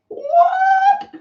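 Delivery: sudden very high disbelieving yelp; shocked squeak at the price; surprised what. Real, not acted. Vlogger stunned by cheap hooks, high yelp.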